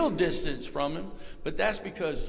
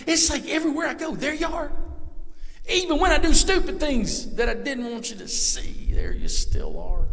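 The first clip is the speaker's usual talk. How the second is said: higher and louder, building passion; preaching fervour; 10 semitones higher, 7 dB louder than usual. Acted. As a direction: preach it to the rafters.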